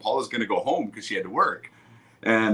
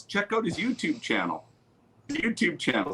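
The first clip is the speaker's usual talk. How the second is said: lightly voiced lady, higher and softer; quoted store lady; 8 semitones higher, 5 dB quieter than usual. Lady tips off departing customer, lightly imitated higher voice. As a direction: pass on a tip in a softer, higher voice. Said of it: "and the lady goes".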